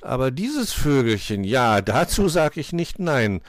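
big, jovial character voice; amused cook. A narrator's reading. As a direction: relish it boisterously as the cook. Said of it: "Der Koch lachte".

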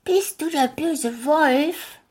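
put-on high, slow, timid child voice; little girl's question. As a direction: ask like a small girl meeting the wolf. Girl Rotkäppchen voiced high.

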